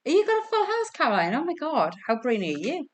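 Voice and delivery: high, swooping astonished congratulations; real amazement. Real, not acted. Quiz host reacting to a player's full house, amazed. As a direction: pitch leaps up in disbelief.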